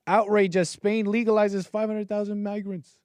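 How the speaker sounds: dramatic headline reading. Acted. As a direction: announce it like a news banner.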